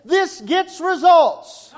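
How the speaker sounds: loud, high, drawn-out proclamation; exultant declaration. Acted. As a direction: proclaim it slowly at the top of a sermon. Preacher's climactic voice of conviction.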